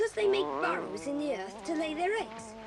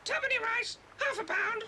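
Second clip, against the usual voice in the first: parrot chatter; 4 semitones higher than usual. Acted. Parrot Kiki chattering nonsense.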